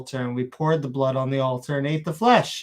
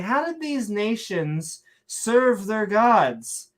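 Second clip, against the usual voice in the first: higher, slow, theatrical fake Shakespearean; mock Shakespearean accent; 5 semitones higher, slower than usual. Reading a question about nations' gods, theatrical mock Shakespearean accent. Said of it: "saying it in a Shakespearean accent".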